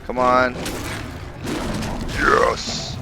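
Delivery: normal talking voice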